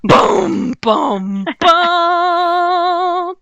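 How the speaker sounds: thunder boom. Acted. DM makes thunder sounds.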